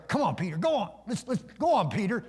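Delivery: high, eager urging from buddies; egging him on. Acted. Buddies around Peter in the boat, egging him on. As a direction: goad a pal into it. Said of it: "his buddies around there go".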